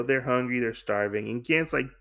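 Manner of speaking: normal talking voice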